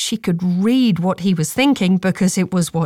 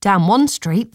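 usual speaking voice

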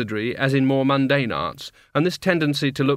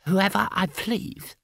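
high, slow creaky door knocker voice; a smug little gargoyle, pleased with itself; pleasant gargoyle; 3 semitones higher than usual. A narrator's reading. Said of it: "said the gargoyle pleasantly".

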